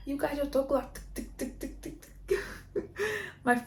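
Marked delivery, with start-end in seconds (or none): 1.1-2.4 voiceless tic tic like a machine; breathy clicking of a heart monitor; ticking monitor noise. Retold or imitated. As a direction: mimic the fetal monitor clicking softly.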